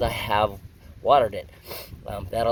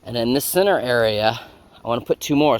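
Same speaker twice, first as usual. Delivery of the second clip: usual speaking voice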